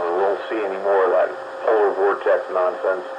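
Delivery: usual speaking voice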